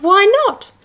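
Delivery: high wheedling why not; high pleading repeat. Acted. Child pleading with her dad.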